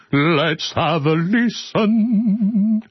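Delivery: high, very slow stretched-out delivery; goofy slow high retake of the intro; drawn-out silly take. Shown as a demonstration. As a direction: drag the line out high and slow for a laugh. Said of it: "retakes of the intro line".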